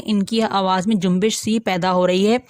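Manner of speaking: ordinary delivery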